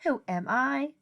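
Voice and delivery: ordinary delivery, nothing marked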